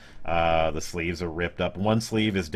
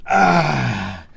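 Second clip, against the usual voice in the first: mock pained yelp; 7 semitones higher, 9 dB louder than usual. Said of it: "I wanna make the Peter Griffin joke".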